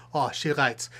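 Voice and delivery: normal talking voice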